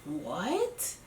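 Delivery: soft, rising, baffled what; hushed, disbelieving what; stunned what. Retold or imitated. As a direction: breathe it out in disbelief. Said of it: "And I'm like".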